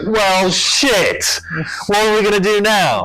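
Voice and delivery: high, loud mock-panicked outburst; dismayed gang exclaiming. Gang reacting to the bomb at Gastown, loud mock dismay. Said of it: "So they're like".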